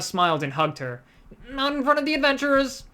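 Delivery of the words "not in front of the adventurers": embarrassed. Acted. Rogue embarrassed by hug.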